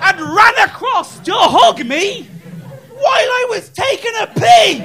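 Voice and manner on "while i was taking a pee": ordinary talk, nothing marked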